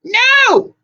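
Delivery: very high, loud scream of refusal. A narrator's reading. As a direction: scream it, all out. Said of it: "they all screamed".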